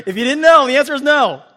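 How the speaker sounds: big laugh through the words; laughing loudly. Real, not acted. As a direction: laugh it out loud. Preacher laughs at own joke.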